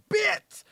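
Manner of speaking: high punchy sound effect. Retold or imitated. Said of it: "we go".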